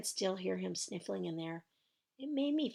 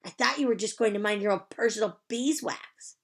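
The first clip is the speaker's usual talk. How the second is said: mild holler; 5 semitones higher, 8 dB louder than usual. Acted. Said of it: "he hollered".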